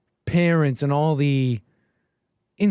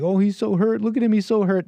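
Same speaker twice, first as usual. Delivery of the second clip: mock pity; 6 semitones higher than usual. Mocking pity for the singer.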